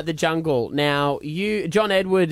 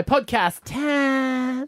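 drawn-out announcement; 7 semitones higher, much slower than usual. Acted.

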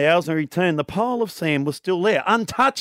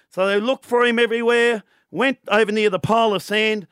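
usual speaking voice

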